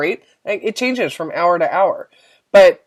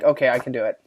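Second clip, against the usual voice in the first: quiet, half-hearted yes; a feeble, oomph-less agreement; limp self-quote; 6 semitones lower, 6 dB quieter, faster than usual. Retold or imitated. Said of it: "where's the oomph behind that?".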